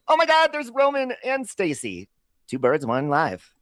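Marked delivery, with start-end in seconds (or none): 0.1-2.1 oh-my-God thrill, starting loud and very high; excited greeting. Real, not acted. Host spots friends Roman and Stacy, high excited greeting.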